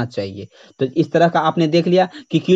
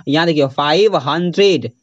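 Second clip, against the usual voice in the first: ordinary delivery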